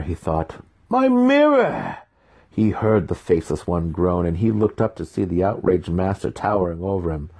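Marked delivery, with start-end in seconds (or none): 0.9-2.0 high, drawn-out cry of loss; a high, long wail for the broken mirror; anguished wail. A narrator's reading. Master's outraged wail over mirror.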